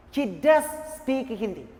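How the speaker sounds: weight on "does", slight gap after it; stress on DOES. Shown as a demonstration. He never speaks any other language, stress on "does". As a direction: insist with the word "does". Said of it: "Emphasized means to give a stress".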